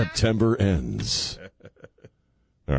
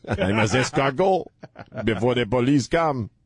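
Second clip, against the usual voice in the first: put-on Clouseau French accent; 4 semitones higher than usual.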